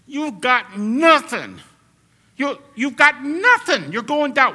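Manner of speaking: loud, high taunting challenge to death. Retold or imitated. Preacher voicing Paul taunting death, loud and high. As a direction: get right in death's face and taunt it. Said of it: "he's talking like this".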